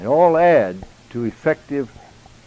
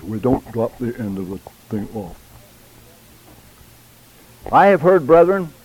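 ordinary delivery

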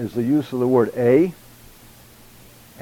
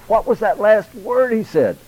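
puzzled listener; 9 semitones higher than usual. Retold or imitated. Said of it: "you find yourself saying".

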